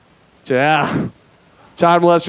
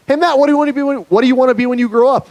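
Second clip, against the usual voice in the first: put-on high-pitched curious kid voice; young kid; 9 semitones higher, faster than usual. Kid asks comedian about his future, high kid voice. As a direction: pipe up high like a child with a question. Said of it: "one kid came up to me".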